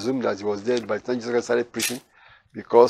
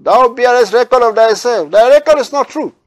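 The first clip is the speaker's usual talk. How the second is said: much higher, louder voice of the Pharisees; accusing Pharisees; 12 semitones higher, 8 dB louder than usual. Acted. Pharisees challenging Jesus in the temple, accusing and high. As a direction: throw the charge at Jesus like a sneering crowd.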